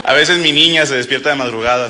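normal talking voice

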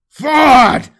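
high, loud battle roar. Acted. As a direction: roar the charge order. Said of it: "Apollos roared".

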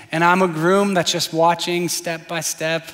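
ordinary talk, nothing marked

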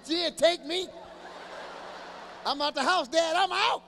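put-on high, loud, triumphant kid leaving home; excited kid shout. Kid shouting to dad on the way out. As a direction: yell it like a teen finally free.

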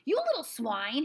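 loud, high, furious yell; an enraged big brother's yell; angry howl. A narrator's reading. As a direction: howl it as you dive at him. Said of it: "he howled".

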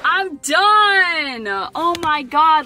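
high, loud cry of joy; excited. Real, not acted. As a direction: celebrate at the top of your lungs. Celebrating finishing the climb.

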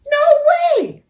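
very high, loud shriek of refusal; kangaroo refusing. A narrator's reading. Kangaroo turning down the door's proposal, high and loud. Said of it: "said the kangaroo".

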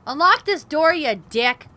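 loud angry shout at the door; angry command. Real, not acted. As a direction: demand it furiously. Yelling at door.

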